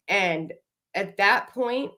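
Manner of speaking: usual speaking voice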